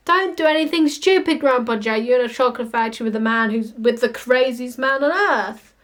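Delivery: ordinary talk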